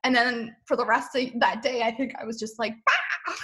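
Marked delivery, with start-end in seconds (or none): 2.8-3.3 a high, sudden yelp of glee; excited outburst. Retold or imitated. Leaving the meditation, bursting with new ideas. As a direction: burst out like an idea just struck.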